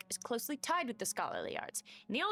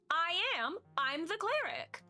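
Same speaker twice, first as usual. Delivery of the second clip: proud declaration; 8 semitones higher than usual. Pretend. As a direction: declare your title proudly. Proud announcement.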